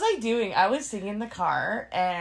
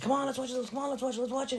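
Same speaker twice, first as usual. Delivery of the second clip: high, quick mock pleading; softer, high-pitched wheedling; nagging boyfriend; 8 semitones higher, 5 dB quieter, faster than usual. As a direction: imitate someone pestering repeatedly. Imitates boyfriend nagging her to watch.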